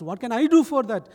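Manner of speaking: high, loud acted question; protesting question. Pastor asking the villagers what he can do, protesting. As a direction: throw the question back loudly.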